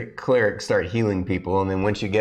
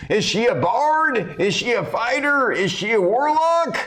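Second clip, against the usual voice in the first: arena announcer; 13 semitones higher than usual. Acted. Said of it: "the announcer's like".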